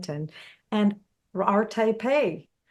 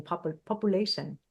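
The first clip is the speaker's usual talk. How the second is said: put-on Indian accent; 4 dB quieter than usual. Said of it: "I heard him on the radio saying".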